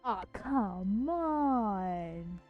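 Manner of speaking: normal talking voice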